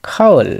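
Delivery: খাওল closed on ল, high and loud; final ল sound. Shown as a demonstration. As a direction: model খাওল firmly, falling onto the ল. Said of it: "সেটি ল হয়ে গেল". (খাওল, শেষে ল দিয়ে জোরে)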